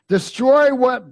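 high, loud question to the crowd; calling out. Real, not acted. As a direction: fire it at the congregation. Call and response to congregation.

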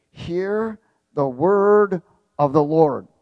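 slow, loud, drawn-out command; commanding proclamation. Retold or imitated. Preacher voices the prophet's command to dry bones, commanding. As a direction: proclaim it forcefully, word by word. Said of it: "commanded whatever it was he was speaking to".

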